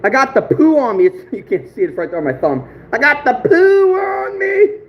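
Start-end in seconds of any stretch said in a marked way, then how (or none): 2.9-4.8 Joe Dirt. Acted. Vlogger quoting a movie joke to viewers, high drawn-out mock wail. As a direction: stretch it out in a high, silly voice. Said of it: "Joe Dirt, baby".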